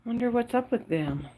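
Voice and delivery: ordinary talk, nothing marked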